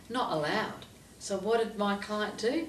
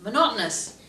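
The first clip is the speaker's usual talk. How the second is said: ordinary delivery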